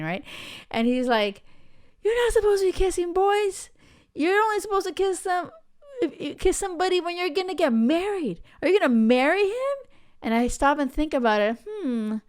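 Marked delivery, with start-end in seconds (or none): 2.0-9.8 angry big brother. Retold or imitated. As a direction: play the outraged big brother, voice pushed way up. Brother lecturing her about kissing.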